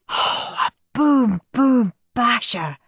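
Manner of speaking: slow, gloating joy; beaming delight. Acted. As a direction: savor the prize. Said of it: "beamed Henry".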